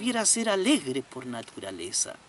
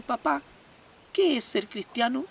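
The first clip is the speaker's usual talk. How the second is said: put-on child voice; 6 semitones higher than usual. Small boy questions his father.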